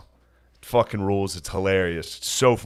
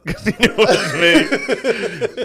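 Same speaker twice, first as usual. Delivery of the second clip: laughing, dragged out slow; giggly slow aside; 11 semitones higher, much slower than usual. Real, not acted. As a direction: laugh while you explain. Laughing while telling.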